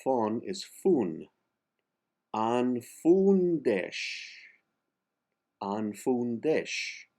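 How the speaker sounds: "Anfundesh" drawn out, E as A, X as SH; slow Catalan demo. Shown as a demonstration. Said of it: "the E in N is an A".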